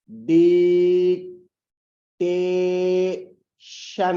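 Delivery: Dictation stretched slowly, syllable by syllable; syllables drawn out. Shown as a demonstration. Teacher stretching dictation slowly to count syllables. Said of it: "कितने syllables हुए?".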